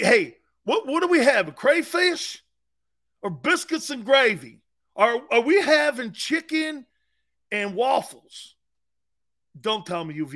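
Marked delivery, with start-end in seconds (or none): 0.0-8.6 mock Southern coach. Mocking coach asking about food.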